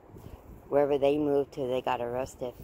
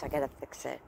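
ordinary delivery

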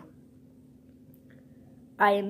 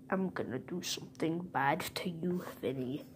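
whispered threat; 2 semitones lower, 9 dB quieter, faster than usual. Pretend. Villain threatens Vinny.

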